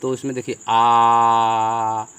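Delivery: आ held long and straight; drawn-out vowel. Shown as a demonstration. Teacher sounding आ slowly for the students, held. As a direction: stretch आ out steady and long. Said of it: "एकदम सीधा आवाज़ जा रहा है आ".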